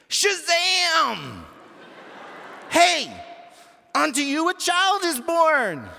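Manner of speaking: high, loud proclamation hollered out. Acted. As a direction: holler it like the best news in the world. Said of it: "She hollered it like it was the best news".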